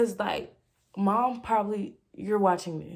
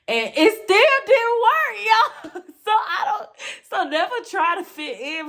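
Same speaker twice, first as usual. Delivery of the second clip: high, loud squeal through laughter; helpless, high-pitched laughing speech; 12 semitones higher, 5 dB louder than usual. Real, not acted. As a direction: burst out laughing at yourself mid-sentence. Laughing at her own failed efforts.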